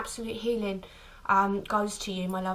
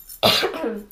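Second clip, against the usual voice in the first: a real strained cough from the throat; choking cough; 8 dB louder than usual. Real, not acted. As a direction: cough as if something is pressing on the throat. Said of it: "it's not even a, a, like, a cough".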